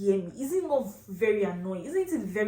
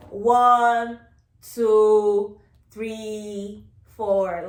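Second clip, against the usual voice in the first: put-on slow counting to a baby; 8 dB louder, much slower than usual. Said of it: "Like you're teaching a baby".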